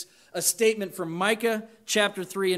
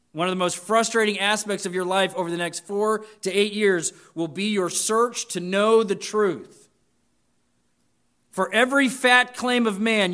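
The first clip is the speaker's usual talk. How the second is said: louder, higher preaching that piles up the list; full-force preaching; 5 semitones higher, 7 dB louder than usual. Acted. Pastor warning students about man's shifting claims, in full-force preaching. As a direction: drive each item home like a revival preacher.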